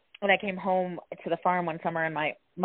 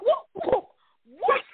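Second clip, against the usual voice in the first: much higher, squeaked incredulous voice; squeaky disbelief; slower than usual. Retold or imitated. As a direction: squeal it like a stunned cartoon. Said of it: "And I was like".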